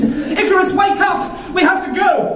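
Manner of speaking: high, urgent call to wake; urgent waking call. Acted. Father waking his son to flee, urgent and high. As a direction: rouse the sleeping son in a hurry.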